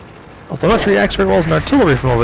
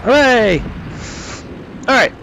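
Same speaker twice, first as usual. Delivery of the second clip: excited cheer; 8 semitones higher, much slower than usual. Real, not acted. Knocks out the Wolverine.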